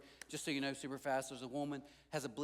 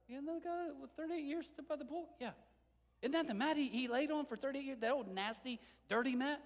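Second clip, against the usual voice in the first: higher, nosy gossip voice; gossiping townsfolk; 10 semitones higher than usual. Acted. Townspeople gossiping about the healed man, higher nosy voice. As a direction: chatter like curious neighbours.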